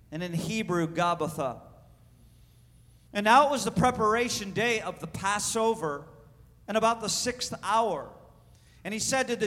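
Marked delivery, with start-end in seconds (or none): none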